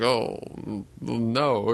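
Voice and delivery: mildly stunned, halting reaction. Retold or imitated. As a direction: retell a dumbfounded, drawn-out response. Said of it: "like, stunned. He's like".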